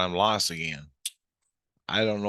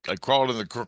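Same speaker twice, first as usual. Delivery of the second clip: ordinary delivery, nothing marked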